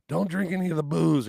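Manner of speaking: loud, high-pitched order; gruff-humoured warning. Retold or imitated. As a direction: call out a warning to the kids. Singer warns the kids off.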